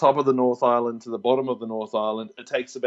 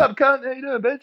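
mock crude greeting to mum; 10 semitones higher than usual. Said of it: "dial mum".

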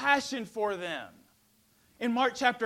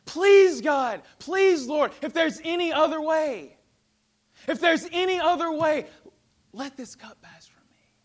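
pleading, much louder and higher; anguished prayer; 6 semitones higher, 8 dB louder than usual. Acted. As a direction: beg God in anguish. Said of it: "pouring out his heart to God".

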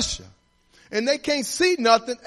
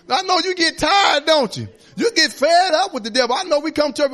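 raised, high, shouting preacher; preaching at full force; 5 semitones higher, 4 dB louder than usual. Real, not acted. Pastor stirring up the church about the devil, a full-force shout. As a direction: drive it home to the congregation.

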